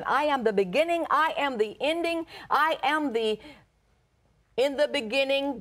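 high, emphatic preacher's delivery; high-pitched sermon proclamation; impassioned preaching. Acted. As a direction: drive each declaration home from the pulpit. Preaches with rising force.

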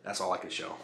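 low, quiet remark. Real, not acted. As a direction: say it softly to yourself. Quiet first take.

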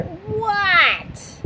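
high drawn-out what; yelling back. Acted. Froggy yells back to his mother, high and drawn out. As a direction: yell back from outside. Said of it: "yelled Froggy".

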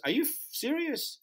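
can't believe what he's hearing; stunned disbelief. Retold or imitated. [name] stunned by the promoter's request, incredulous. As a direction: pitch shoots up in shocked disbelief.

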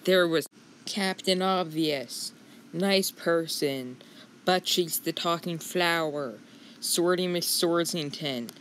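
ordinary delivery

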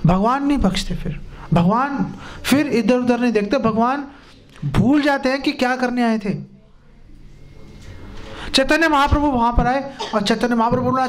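ordinary talk

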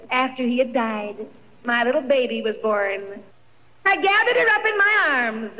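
ordinary delivery

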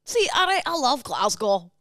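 imitated Scottish accent, starting high. Co-host mimics host's line, Scottish accent. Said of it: "but in the accent".

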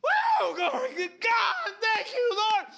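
whooping high, drawn-out praise; very loud, high-pitched jubilant cries; imitated celebratory shout. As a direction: mimic a groom overcome, hollering to heaven. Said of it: "celebrate and shout".

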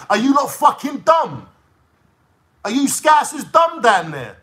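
real outraged shout at the scousers; angry shout. Real, not acted. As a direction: bellow it in disbelief. Furious rant at Liverpool listeners.

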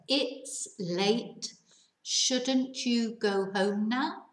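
"Shouldn't you go home now" drawn out slowly, rising at the end; slow it down so learners catch every word; slow reading. Shown as a demonstration. Teacher reads the example slowly to students. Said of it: "voy a leerlo, lento".

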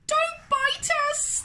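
high, slow frightened shriek; children shrieking in fright; squealing plea. A narrator's reading. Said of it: "they'd shriek".

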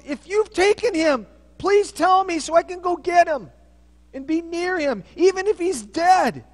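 loud, high, pleading cry; desperate plea. Acted. Mary begging the gardener to return Jesus' body, loud and desperate. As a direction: beg with everything you have.

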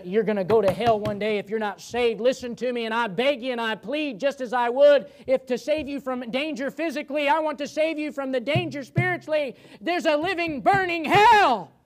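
high, loud hellfire preaching; fiery preaching. Acted. Preacher warning the congregation about hell, impassioned. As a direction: plead with the congregation at full force.

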